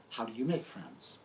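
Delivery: usual speaking voice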